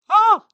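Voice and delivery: sudden loud, high-pitched cry; short shriek of alarm under attack; startled scream. A narrator's reading. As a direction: scream out as the bird lunges. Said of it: "비명을 지르면서".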